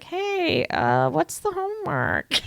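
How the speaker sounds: high, drawn-out fake-casual voice; pretending it's real. Caught prank caller asking classmate for homework, in high fake-casual voice. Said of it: "pretend it was a real call".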